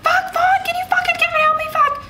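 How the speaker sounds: frantic cursing. Retold or imitated. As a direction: replay the stuck-choker meltdown at a shriek. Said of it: "It's like".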